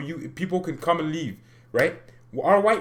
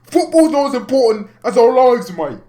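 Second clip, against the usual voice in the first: high, shouted mock British protest; British accent; 11 semitones higher, 12 dB louder than usual. English fan protesting lives matter more, shouted British accent. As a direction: yell it as a hammed-up British fan. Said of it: "That's why I'm doing the British accent".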